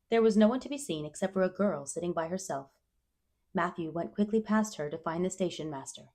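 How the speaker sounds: usual speaking voice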